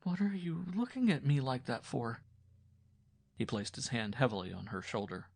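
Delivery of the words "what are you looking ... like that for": uncertain voice. A narrator's reading. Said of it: "her voice was uncertain".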